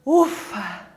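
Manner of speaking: low "ufa" on a falling breath; breathy, relieved "ufa"; sigh of relief. Shown as a demonstration. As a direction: let it out as a relieved exhale. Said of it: "De alívio".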